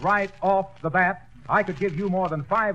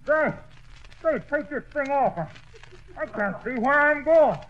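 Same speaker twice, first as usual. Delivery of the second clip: higher, rising alarmed calls; muffled panic; 8 semitones higher than usual. Acted. Wilcox calling for help with a lantern over his head. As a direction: call out blindly, growing frantic.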